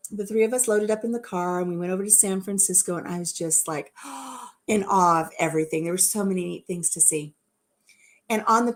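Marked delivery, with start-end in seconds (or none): 4.0-4.6 soft sharp intake of breath in awe; a quiet, breathy gasp of wonder; awed gasp. Retold or imitated. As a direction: gasp like a wide-eyed tourist. In awe at San Francisco.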